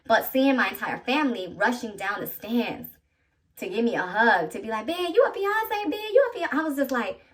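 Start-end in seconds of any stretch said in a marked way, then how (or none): 4.9-6.6 high-pitched congratulatory squeal; excited relatives. Retold or imitated. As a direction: imitate family squealing the big news. Relatives rushing over to congratulate her.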